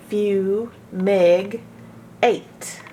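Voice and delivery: "Fume", "Meg", "Eight" as separate beats with pauses between; syllable split. Shown as a demonstration. Teacher splitting "fumigate" into its syllables. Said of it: "closed in by the G".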